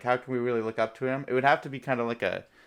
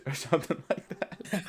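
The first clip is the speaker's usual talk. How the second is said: normal talking voice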